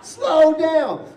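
high, loud pleading scream; screaming stop. Retold or imitated. Patient yelling at nurses, loud high pleading. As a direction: yell at them to slow down. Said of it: "and I scream".

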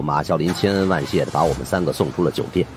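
plain reading voice

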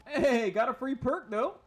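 high, loud, delighted whoop; excited cheer. Real, not acted. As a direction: cheer the lucky break. Gets a free perk.

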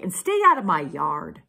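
loud, cross order; grumpy storybook cactus yelling; angry yell back. A narrator's reading. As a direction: order them off your property. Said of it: "Hank yelled back".

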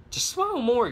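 "smile" jumps up high and loud. Shown as a demonstration. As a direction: belt the motto out. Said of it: "love your motto, "Smile more".

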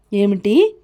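ordinary delivery, nothing marked

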